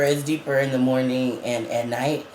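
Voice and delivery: ordinary delivery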